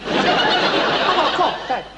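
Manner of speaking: high, slow whiny plea for sympathy; milk it like a dying man; pitiful complaint. Acted. Son claims illness pitifully.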